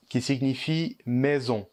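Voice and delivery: normal talking voice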